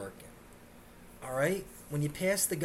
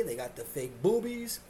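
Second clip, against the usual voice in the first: ordinary delivery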